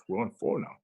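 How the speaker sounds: quick whispered aside. Real, not acted. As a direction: whisper it under breath.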